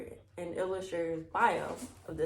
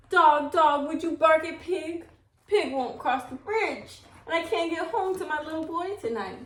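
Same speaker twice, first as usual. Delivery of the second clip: pleading; 7 semitones higher, 4 dB louder than usual. A narrator's reading. Old woman pleading with the dog.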